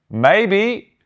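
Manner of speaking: high, sing-out British "maybe"; loud, lifted British voice, rising at the end; breezy British officer on the radio. Retold or imitated. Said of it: "like, this British voice on the radio".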